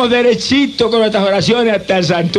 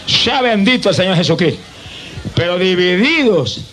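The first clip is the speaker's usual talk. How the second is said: ordinary talk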